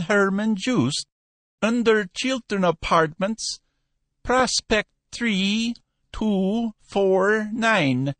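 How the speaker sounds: high, slow, drawn-out shopkeeper; high dealer voice, unhurried; Cohen the dealer. Acted. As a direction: lecture him patiently, slowly. Cohen continues on phone.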